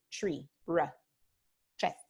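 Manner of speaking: tree with an English r, then tres alike. Shown as a demonstration. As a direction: blend tr as in tree. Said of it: "como en inglés".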